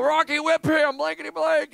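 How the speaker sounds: high, loud heckling; drunken yelling. Retold or imitated. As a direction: imitate a rowdy drunk cursing at the screen. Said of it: "he's yelling".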